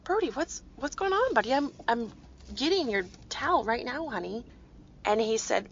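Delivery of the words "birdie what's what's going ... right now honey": put-on high, tender reassurance to a crying child; mother calming her sobbing son over bath time; comforting child. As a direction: soothe him as you call down the hallway. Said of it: "And I said".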